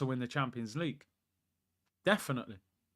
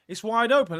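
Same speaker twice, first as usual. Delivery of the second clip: raised, louder declaration; excited; 7 semitones higher, 7 dB louder than usual. Real, not acted. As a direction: call it out loud. Excited claim while watching.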